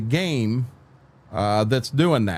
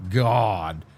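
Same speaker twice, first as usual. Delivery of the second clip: normal talking voice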